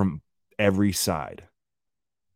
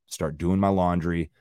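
ordinary delivery

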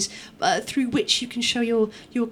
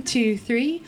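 usual speaking voice

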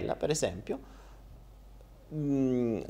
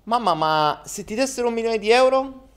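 put-on childish boy voice; 10 semitones higher than usual. Boy asks mother.

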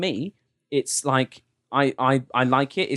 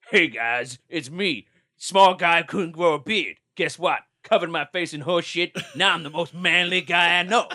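imagined caller voice; 3 semitones higher than usual. Pretend. Imagined caller calling back.

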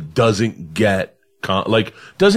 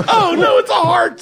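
high shocked cry of dismay. Real, not acted. Friend reacting to the sky, high shocked cry. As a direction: gasp it out in sudden dismay.

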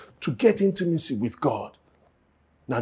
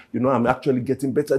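ordinary talk

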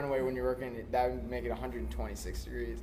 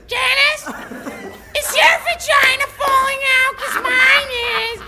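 put-on very high, loud old lady; 14 dB louder than usual. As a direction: squeal it like a frantic old lady. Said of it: "really she was like".

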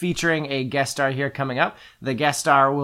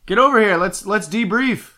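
calling out; 6 semitones higher, 5 dB louder than usual. Acted. Arthur calling her in.

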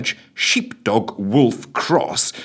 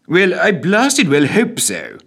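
muttering PM; 9 semitones higher, 4 dB louder, slower than usual. A narrator's reading. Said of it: "muttered the P M".

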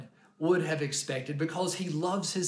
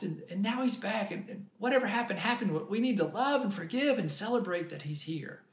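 gentle father; 8 semitones higher than usual. Pretend. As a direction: coax the older son tenderly. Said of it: "He's like".

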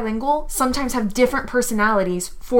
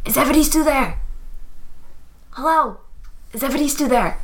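stage whisper; 3 semitones higher, faster than usual. Acted. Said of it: "I whisper shout".

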